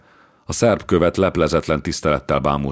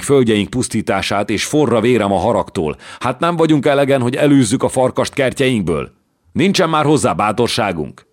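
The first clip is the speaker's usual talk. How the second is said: building to a loud, high-pitched tirade; dühödt szónoklat; 6 semitones higher, 4 dB louder than usual. A narrator's reading. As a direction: rouse and shame them, growing more heated. Hunyadi dühödten szónokol az uraknak. (egyre hevesebb, haragos szónoklat)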